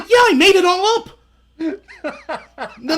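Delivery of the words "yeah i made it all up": gleeful confession. Retold or imitated. Punchline confession told gleefully.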